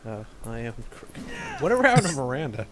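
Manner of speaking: normal talking voice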